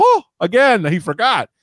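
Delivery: high-pitched pleased gasp; relieved waking woman. Acted. Woman waking unpunched next morning, high mock relief. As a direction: marvel in a hammed-up high voice. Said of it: "wake up next morning and go".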